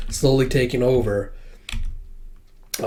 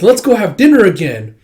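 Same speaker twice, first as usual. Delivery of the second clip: bright male voice; 7 semitones higher, 8 dB louder than usual. Retold or imitated. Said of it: "He's like".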